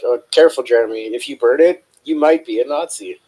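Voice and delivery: retold sarcasm. Cook warning Jeremy on the way out, retold sarcasm. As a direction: deliver a sarcastic parting shot. Sarcastic parting shot in retelling.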